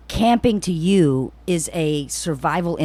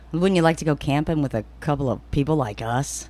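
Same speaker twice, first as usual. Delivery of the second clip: ordinary talk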